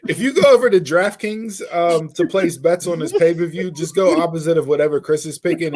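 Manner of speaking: ordinary talk, nothing marked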